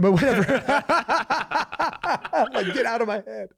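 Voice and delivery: giggling get out of my head; laughing. Real, not acted. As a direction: laugh while brushing it off. Joking about voices in head.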